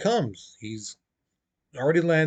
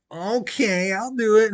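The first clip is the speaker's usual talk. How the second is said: higher, put-on voice of a willing brother; mock-eager acceptance, pitched up; eager volunteer; 6 semitones higher than usual. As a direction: agree in a higher, hammed-up voice. Voicing the king's eager brother.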